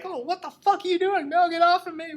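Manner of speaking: very high, panicked squeal; Ricky. Retold or imitated. Host acts Ricky being dragged into the woods, shrieky protest. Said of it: "Ricky's like".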